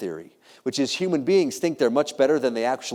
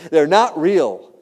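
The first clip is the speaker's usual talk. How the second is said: "they're not real" loud, higher and drawn out; punchline on "not real", each word stretched; emphatic revelation; 4 semitones higher, 8 dB louder, much slower than usual. Retold or imitated. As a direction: land "not real" slowly with full force. Dog's revelation, climax of its speech.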